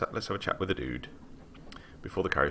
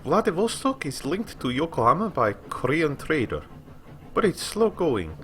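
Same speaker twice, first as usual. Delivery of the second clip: put-on higher, bright young woman's voice; lightly voiced helpful lady; 9 semitones higher than usual. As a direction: answer as Rosa, obliging. Voices Rosa.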